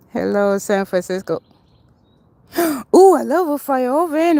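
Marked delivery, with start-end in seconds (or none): 2.5-4.4 gasping ooh, higher and louder; delighted sharp intake then ooh; thrilled gasp at the sight; excited gasp. Real, not acted. Excited reaction to fire oven.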